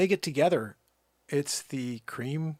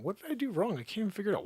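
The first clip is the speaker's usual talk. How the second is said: mock confused user; 6 semitones higher, 4 dB quieter, much faster than usual. Mocking the banned user.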